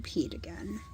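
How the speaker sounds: low whispered aside; whispering. Real, not acted. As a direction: drop to a whisper for the confession. Embarrassed aside about laundry.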